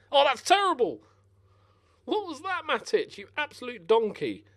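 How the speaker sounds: high, loud outraged disbelief; exasperated. Real, not acted. Gamer scolds Matic for a terrible pass. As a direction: berate the clumsy midfielder.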